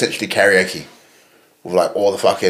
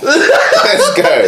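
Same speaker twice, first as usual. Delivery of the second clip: high laughing cheer; laughing excited let's go; laughing hype; 5 dB louder than usual. Real, not acted. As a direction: cheer it through real laughter. Hyped over a show idea.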